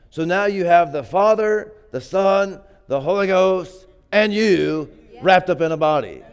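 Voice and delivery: ringing out the Trinity to the church; rising preaching fervor. Real, not acted. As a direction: preach it with mounting power. Preacher building to climax.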